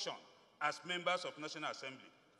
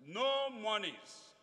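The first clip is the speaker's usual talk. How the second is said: No drawn out slowly and firmly, falling; slow, deliberate stress on No; emphasis on no; 2 semitones higher, much slower than usual. Shown as a demonstration. Reading subsection three to the House, No stressed. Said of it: "emphasis again on no".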